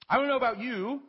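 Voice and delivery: ordinary talk, nothing marked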